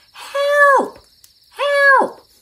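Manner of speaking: high, loud cry of help; two boys yelling help by the road; shouting for help. A narrator's reading. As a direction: call out desperately to passing cars. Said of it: "we'll shout and we'll scream real loud".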